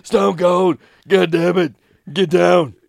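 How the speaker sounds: loud, hammed-up wrestler bellow; wrestling promo shout. Stone Cold wrestling impression.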